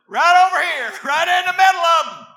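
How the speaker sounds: sudden loud pulpit cry; exultant shout. Real, not acted. Preacher claiming his place among the righteous, exultant shout. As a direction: burst out shouting to the congregation.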